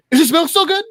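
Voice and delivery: high, loud mock interrogation; manic demand. Maniac quizzing his captive on milk, high loud demand.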